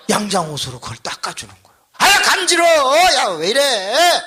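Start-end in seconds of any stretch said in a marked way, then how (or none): 2.0-4.2 high, loud, startled squeal; ticklish protest. Retold or imitated. Husband protesting as wife washes his feet, high ticklish yelp. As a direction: imitate a flustered husband squirming from tickles.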